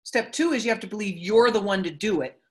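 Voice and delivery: normal talking voice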